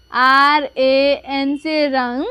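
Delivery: careful classroom spelling; slow spelling. Shown as a demonstration. Teacher slowly spells a word for pupils. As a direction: spell each letter slowly. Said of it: "तो देखिए".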